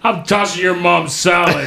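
imitated trash-talker. Stranger taunting an eleven-year-old online, imitated taunt. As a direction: lightly mimic an online heckler. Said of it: "I hear this guy tell".

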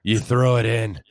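mock-instructor voice, played for laughs; put-on voice. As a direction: explain it in a put-on voice. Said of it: "I'll do it in this voice".

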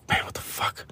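whispered, stunned at himself; astonished whisper. Retold or imitated. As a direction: breathe it out in shock. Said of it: "I was like".

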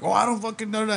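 high-pitched, lightly mocked protest; retold protest. Retold guy being yelled at.

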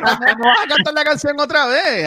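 laughing teasing question. Real, not acted. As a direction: tease him through a laugh. Teasing co-host, laughs heard. (riéndose mientras bromea)

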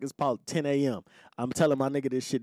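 ordinary talk, nothing marked

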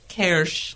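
Kirsch with rounded ö, nearly "kersh"; German pronunciation of Kirsch; native-style Kölsch. Shown as a demonstration. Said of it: "How do you say it, [name]?".

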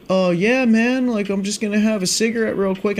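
high, relaxed buddy voice; buddy's laid-back reply. Retold or imitated. Buddy answering from the car, high laid-back voice. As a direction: play the chill friend lighting up.

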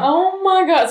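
high, loud shocked gasp. Real, not acted. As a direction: recoil at the horror. Reacts to child sacrifice.

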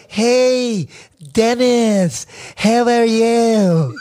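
put-on high, slow Eagle Rock Filipino accent; hammed-up Eagle Rock greeting with "eh" vowels. Host imitating how kids greet Dennis, Eagle Rock accent. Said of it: "Listen to how they talk".

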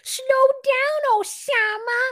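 high, loud put-on plea; mock Debbie plea. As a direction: beg him to slow down in a silly high voice. Co-host voices Debbie again.

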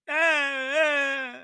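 mock cry. Mock cry of hurt player.

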